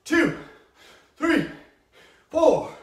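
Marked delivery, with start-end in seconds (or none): 1.2-2.7 straining with effort. Real, not acted. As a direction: groan it out mid-exercise. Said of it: "it burns".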